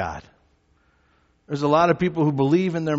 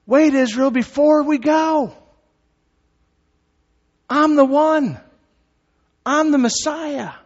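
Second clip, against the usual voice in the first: high, raised, drawn-out call; shouting it out; 9 semitones higher, 4 dB louder than usual. Acted. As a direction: call out to the whole nation. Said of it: "He's shouting it out".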